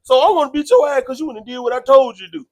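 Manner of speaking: mock accusation. Imagined instigator blames man for obeying him, heated accusation. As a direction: act out blaming someone, loud and rushed.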